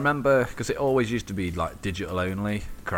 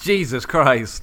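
higher, louder, slow disbelief; loud slow gasp of surprise; shocked exclamation; 6 semitones higher, 8 dB louder, much slower than usual. Real, not acted. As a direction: drag it out in disbelief. Shocked at Kerrang lanyard.

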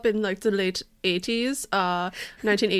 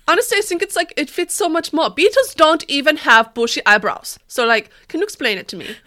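higher, louder indignant whine; offended character voice; 6 semitones higher, 7 dB louder than usual. Acted. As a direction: protest like a wounded giant. Still voicing John Senior.

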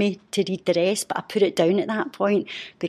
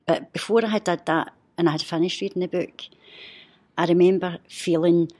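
usual speaking voice

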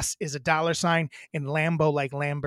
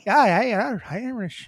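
mock Irish lilt, higher pitched; Irish accent attempt; 4 semitones higher than usual. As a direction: try out a hammed-up Irish accent. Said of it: "Did you see me go straight to Irish?".

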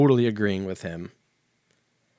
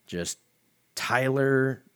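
ordinary talk

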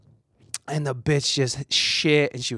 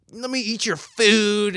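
dog's eager demand, pitched higher; playful dog voice; 6 semitones higher than usual. Retold or imitated. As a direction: voice the nosy dog, a little higher. Voicing a pushy dog.

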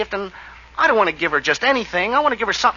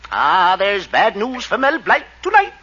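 newscaster; 2 semitones higher than usual. Acted. Parody news sign-off.

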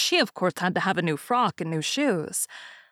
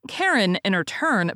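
plain reading voice

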